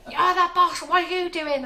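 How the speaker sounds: loud, high-pitched exasperated telling-off; hammed-up indignant yelling; mock scolding. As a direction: imitate an outraged colleague hauling someone up. Retold telling-off by colleague.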